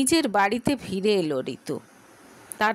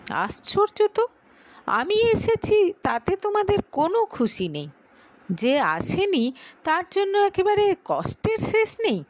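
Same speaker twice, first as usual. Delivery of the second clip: higher, exasperated, snapping at family; irritated outburst; 9 semitones higher than usual. Acted. Ritu, fed up at lunch, snaps at her mother. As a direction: fling it at them and storm off. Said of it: "ভীষণ বিরক্ত হয়ে".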